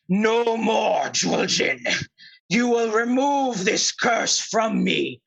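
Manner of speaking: towering bear. Acted. Bear towering over the troll, demanding release. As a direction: boom it out as a towering cursed bear. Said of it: "And the bear, like, towering over you, says".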